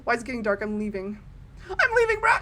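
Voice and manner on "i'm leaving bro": high-pitched, urgent protest; jumpy, raised-pitch alarm; panicky exclamation. Real, not acted. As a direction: blurt it out to get away. Scared of dark, repeats louder.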